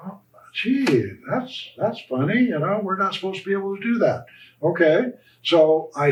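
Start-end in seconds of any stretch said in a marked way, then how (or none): 0.1-4.3 mock surprise. Mock surprised reaction to result.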